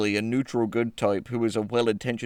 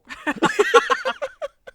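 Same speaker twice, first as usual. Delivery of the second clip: shrill laugh; 3 dB louder than usual. Real, not acted.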